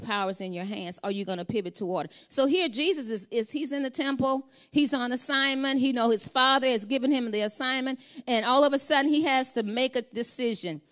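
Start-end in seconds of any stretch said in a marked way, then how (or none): 2.3-10.8 higher, louder preaching that keeps building; full-force preaching. Acted. Preacher urging the congregation not to surrender, preaching hard. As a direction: drive the point home from the pulpit.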